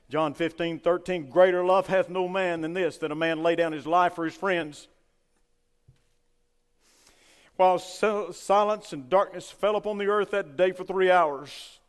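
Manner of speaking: loud, driving preacher's cadence; fiery preaching. Acted. Preacher to his congregation, preaching at full force.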